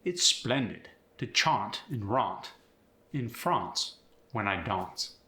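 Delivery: British accent, AH in chant, rant, France, dance; splendidly British 'chahnt' and 'dahnce'; British broad A; British AH sound. Shown as a demonstration. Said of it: "are gonna become".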